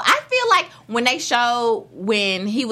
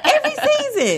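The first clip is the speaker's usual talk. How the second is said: high laughing repeat; laughing squeal. Real, not acted.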